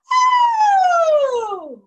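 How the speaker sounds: loud high oh sliding down. Shown as a demonstration. Teacher shows the falling acorn with her voice. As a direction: slide your voice from high to low. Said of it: "from high to low".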